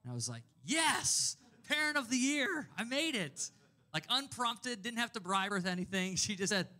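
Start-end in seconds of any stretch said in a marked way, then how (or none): 0.6-3.5 high, loud victory cheer; mock-proud whoop of triumph; jubilant triumph. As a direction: crow like you just won a trophy. Thrilled at daughter's unprompted love.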